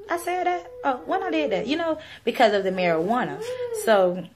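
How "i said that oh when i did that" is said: high, baffled disbelief; mock-puzzled at her own memory; a forgetful stoner's surprise; confused. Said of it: "I'd be like".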